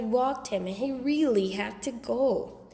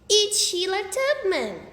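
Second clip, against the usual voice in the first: put-on little kid voice; 9 semitones higher, 5 dB louder than usual. A narrator's reading.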